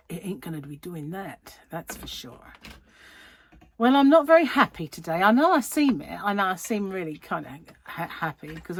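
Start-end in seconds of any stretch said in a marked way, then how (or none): 0.1-2.5 hushed whispered aside. Acted. Whispered aside to viewers about the camera following her.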